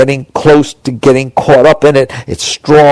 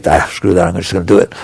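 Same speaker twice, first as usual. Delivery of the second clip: giving in to a craving; 6 semitones lower, 3 dB quieter, faster than usual. Retold or imitated. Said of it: "stop smoking or stop doing drugs feels better when they".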